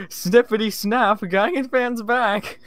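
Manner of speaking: high, drawn-out showy intro; big high-pitched opening call; catchphrase shout. Acted. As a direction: belt out a catchphrase entrance, slowly. Announcing his own catchphrase.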